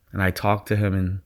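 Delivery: usual speaking voice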